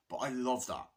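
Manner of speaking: ordinary talk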